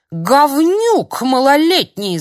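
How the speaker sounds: медленный визгливый ор, намного выше и громче; орать вслед уходящему во всё горло. Acted. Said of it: "заорал дядя Юра вслед". (старик орёт вслед Филиппу)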